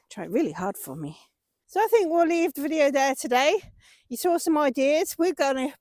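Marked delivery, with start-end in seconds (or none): 0.1-1.2 whispering. Real, not acted. Cooing to the horse.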